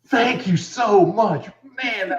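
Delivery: breathless thanks after a good scare; grateful horror veteran. Retold or imitated. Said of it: "then just like".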